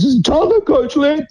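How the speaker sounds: mock the coach calling out of the blue; Gordy Hunter. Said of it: "he says".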